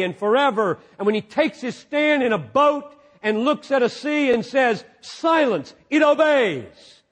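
high, loud, shouting pulpit climax; preacher at full cry, slowing on the last words; thundering preaching. Acted. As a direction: proclaim God's power over the storm.

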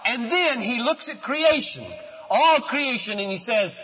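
loud, high preaching build; full-force preaching. Acted. Preacher proclaiming loudly to his church. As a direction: preach it out to the congregation.

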